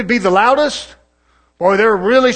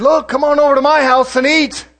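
higher, louder prophet voice; preacher acting out the Old Testament prophets; acted old prophet; 7 semitones higher, 6 dB louder than usual. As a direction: play the pushy old prophet. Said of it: "He said".

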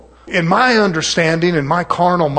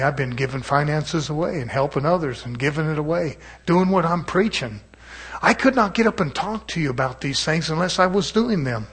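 ordinary talk

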